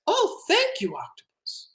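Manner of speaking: put-on high, soft little-boy voice; grateful boy. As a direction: start high and fade into a whispery thanks. Boy gratefully accepts the bath.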